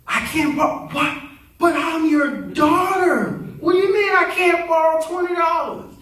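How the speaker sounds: high-pitched mock outrage; hammed-up, high protest; indignant disbelief. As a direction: play the daughter who can't believe she's refused. Acted daughter refused twenty dollars.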